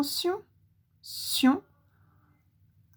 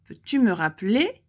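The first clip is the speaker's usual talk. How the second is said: ordinary talk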